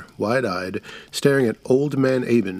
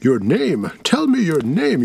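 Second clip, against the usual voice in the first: higher, louder demand for the name; crying out; 6 semitones higher, 3 dB louder than usual. A narrator's reading. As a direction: demand his name, rising to a cry. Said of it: "I cried".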